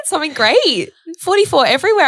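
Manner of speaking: high, loud thrilled exclamation; acted-out giddy delight; retold excitement. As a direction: gush at a lucky sign. Retold thrill at lucky number.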